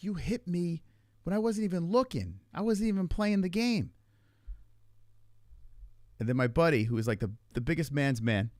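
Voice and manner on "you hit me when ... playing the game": high-pitched retold self-defence; indignant protest. Retelling the water gun argument with her, indignant protest. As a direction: argue back in a high, aggrieved voice.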